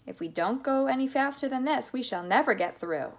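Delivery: loud, emphatic warning; urgent cry. A narrator's reading. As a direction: press them urgently for speed. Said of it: "cried Mister Wonka".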